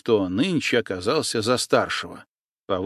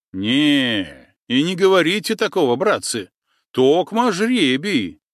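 louder, higher, protesting hotly; indignant outburst; 5 semitones higher, 4 dB louder than usual. Acted. Cossack flaring up at his comrades, indignant and loud. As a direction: throw the words out in angry protest. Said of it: "немедленно возмутился Андрей Каусов".

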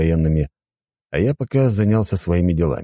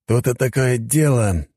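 thoughtful; 6 semitones higher, slower than usual. Acted. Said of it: "задумчиво проговорил он".

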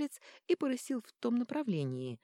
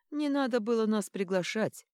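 smirking; 5 semitones higher, 5 dB louder than usual. Acted. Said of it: "усмехнулся Рюворон".

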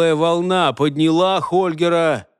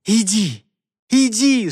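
tearful, rising dismissal; sniffling; 5 semitones higher than usual. A narrator's reading. Crying girl driving Holger off, sniffling. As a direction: shoo him away, climbing higher on the repeat. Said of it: "шмыгая носом, гнала его Леонора".

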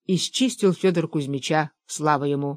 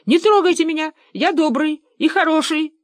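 high, loud plaintive voice; pleading small creature; 10 semitones higher, 4 dB louder than usual. Acted. Fyodor Kuzmich begs the hunters to spare him, high pleading.

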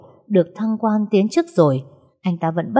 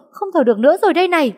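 higher, louder and fast, panic peaking; frantic scream; 11 semitones higher, 5 dB louder, much faster than usual. Acted. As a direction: scream it as the panic boils over. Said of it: "vừa hét". (hét thất thanh, cao, nhanh và to)

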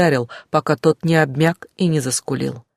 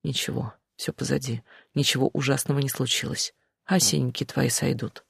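much softer, soothing reassurance; reassuring; 9 dB quieter than usual. A narrator's reading. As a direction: comfort her gently, quietly. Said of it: "время от времени повторял".

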